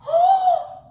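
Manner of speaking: sharp high gasp of wonder; delighted gasp. Real, not acted. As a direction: gasp in amazement. Magic milk colors start moving.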